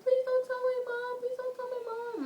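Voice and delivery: high, pleading mock sob voice; imitating crying plea. Friend crying to the dad not to tell, high and pleading. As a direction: beg through imitated tears. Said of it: "like, really crying".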